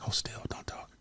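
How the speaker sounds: soft pretend whisper; mock intimate hush. Joking with crying co-host, whispered mock hush. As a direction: whisper it like hushing a lover.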